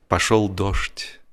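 ordinary delivery, nothing marked

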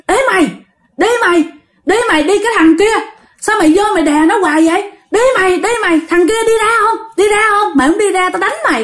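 high-pitched, rapid-fire scolding; old man driving someone off. Acted. Old man shoos the ghost off the sleeper, high and scolding. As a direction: chase him away with sharp, repeated orders. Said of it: "Là ông già đuổi cái thằng đó".

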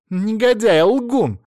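high, loud outburst; angry insult. A narrator's reading. Mother-in-law cuts into his TV speech, furious heckle.

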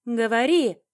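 high, loud furious yell; explosive shouted demand; screamed command. A narrator's reading. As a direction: scream the order at your captive. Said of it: "прокричала Катрин Бетторит".

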